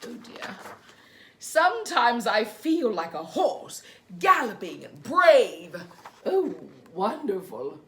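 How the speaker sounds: put-on reading to children. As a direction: read gently to little ones. Reads picture book to children.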